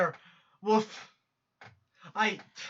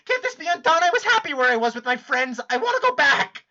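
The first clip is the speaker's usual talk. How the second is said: tearful pleading; 3 semitones higher, 5 dB louder than usual. Acted. Jade begs to return to her friends, tearful and louder. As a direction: beg to go home, voice breaking. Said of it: "this fucking crying's making me lightheaded".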